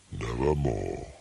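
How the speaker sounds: low, hollow raven voice; a dark low croak of a word; raven's croak. A narrator's reading. The raven answers the narrator, low and hollow.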